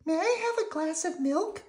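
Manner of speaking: put-on very high, little-girl puppet voice; sweet falsetto child; Prairie Dawn. A narrator's reading. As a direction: ask politely in a tiny high squeak. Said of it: "Prairie Dawn asked".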